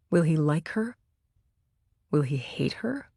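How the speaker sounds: anxious whisper. A narrator's reading. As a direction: whisper the worry under her breath. Anxious inner worry.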